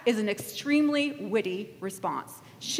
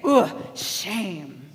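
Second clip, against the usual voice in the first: lower, drawn-out acted scorn; mock disapproval; 5 semitones lower than usual. Crowd shaming a woman, mock disapproval. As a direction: groan it as a shaming verdict. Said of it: "you guys too would say".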